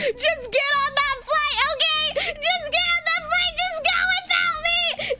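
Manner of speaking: high-pitched, frantic urging; frantic retold plea. Pleading with friends to leave.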